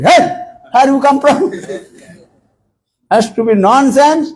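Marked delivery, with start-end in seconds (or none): 0.0-1.5 raised, rough hail; mock rude call. Devotee hailing a scholar rudely, raised voice. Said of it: "Become very".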